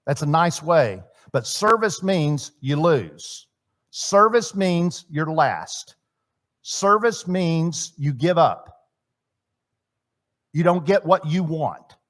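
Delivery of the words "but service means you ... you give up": slow emphatic. Real, not acted. Preacher slowing for emphasis.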